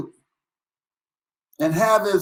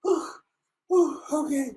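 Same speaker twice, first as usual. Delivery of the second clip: 1 catching breath; 7 semitones higher, 4 dB quieter than usual. Real, not acted. Catching breath after laughing.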